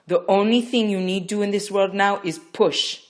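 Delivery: loud, high, stressing only; urgent command. Acted. Midwife ordering Rachel to push, urgent. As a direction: hammer home the one task. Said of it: "more urgently".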